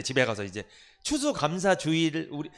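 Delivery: ordinary delivery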